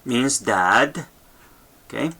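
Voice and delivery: normal talking voice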